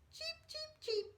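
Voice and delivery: very high bird cheeping; high, light cheep cheep; bird chirp. Retold or imitated. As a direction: chirp like a tiny scarlet bird. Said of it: "he said".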